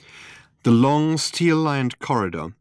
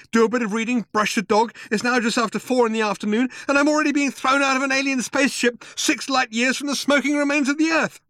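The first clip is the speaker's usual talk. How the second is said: high, fast tirade of grievance; complaining; 3 dB louder, faster than usual. A narrator's reading. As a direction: rattle off the day's woes, climbing higher. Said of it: "complained Arthur".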